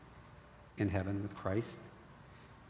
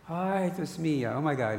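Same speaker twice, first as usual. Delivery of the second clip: Spanish exclamation; 7 semitones higher, 6 dB louder than usual. Retold or imitated. Father exclaiming in Spanish to his son, overjoyed.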